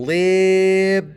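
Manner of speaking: "Libby" drawn out, Lib and bee held apart; two distinct vowels. Shown as a demonstration. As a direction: stretch both syllables of "Libby" to show two different vowels. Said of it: "vowels in Libby are not the same".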